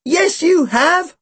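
high, slow emphatic shout. A narrator's reading. Narrator prompting his partner to claim a gun, shouting. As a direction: call it out high, stretching every word. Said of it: "I shouted".

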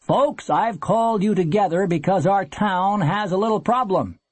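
a touch of public announcement; town meeting address. A narrator's reading. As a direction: address the townsfolk. Recalled speaker addressing a town meeting.

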